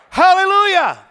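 exultant full-voice cry, high and loud; shouted hallelujah. Real, not acted. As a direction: throw it to the rafters. Shouting praise in sermon.

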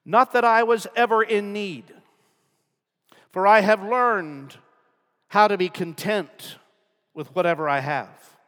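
loud, high scripture proclamation; booming preacher reading scripture; preaching at full force. Acted. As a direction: proclaim the verse to the rafters.

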